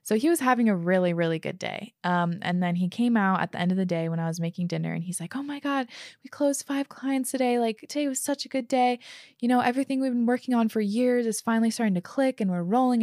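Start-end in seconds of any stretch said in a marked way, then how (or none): 5.4-13.0 imitating an excited partner, higher pitch; lightly acted happy, excited report; jazzed and excited. Partner announces five closed clients to his wife, jazzed and excited. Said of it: "he just was so jazzed and excited".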